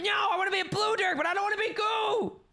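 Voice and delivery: whiny protest. Pretend. Player protesting the transformation, high pleading shout. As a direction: beg not to be turned to goo.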